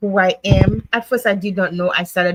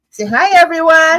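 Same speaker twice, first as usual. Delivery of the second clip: raised, higher-pitched coaxing call; calling out prompt; 8 semitones higher, 7 dB louder than usual. Real, not acted. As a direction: prompt them loudly to greet the viewers. Prompting someone to greet viewers.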